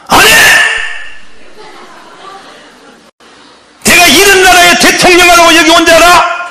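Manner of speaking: high, loud indignant shout; angry refusal. Retold or imitated. Rhee shouts at the man offering him the presidency, furious. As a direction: bellow it like an insulted leader.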